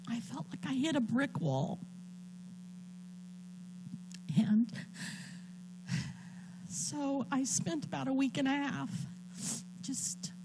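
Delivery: quietly pushing through the tears; choked up. Real, not acted. Telling the congregation of her fears, tearful and soft. Reliving diagnosis, emotional.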